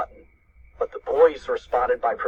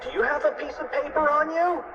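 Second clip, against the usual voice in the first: high-pitched, urgent question; urgent cry; 13 semitones higher than usual. A narrator's reading. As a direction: ask it high, in a cartoon emergency. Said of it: "cried Fluffy".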